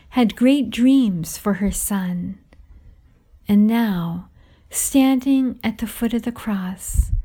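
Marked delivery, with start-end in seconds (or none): none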